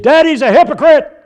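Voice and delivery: high, loud mimicked kid shouting from the back seat; child's yell. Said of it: "my oldest son yelled from the back seat".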